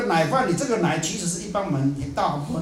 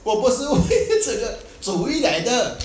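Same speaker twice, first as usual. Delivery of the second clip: feigning sincerity; 9 semitones higher, 6 dB louder than usual. Retold or imitated. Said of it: "他就故意讲啊".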